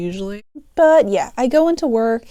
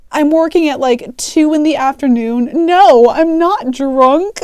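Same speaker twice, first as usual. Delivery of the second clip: louder, higher mock outrage; indignant denial; 4 semitones higher, 6 dB louder than usual. Teen cashier denying being drunk, acted indignation.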